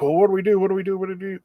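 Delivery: mock panic. Retold panicked group asking for help.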